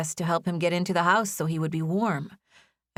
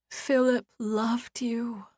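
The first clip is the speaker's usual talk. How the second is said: slow unvoiced whisper; slow tender whisper; 5 semitones higher, much slower than usual. A narrator's reading. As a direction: whisper it like a promise. Comforting whisper to Evan.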